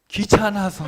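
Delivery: loud, high-pitched outburst of an excuse; blurted excuse. Acted. A child admits why they didn't listen, loud blurted excuse.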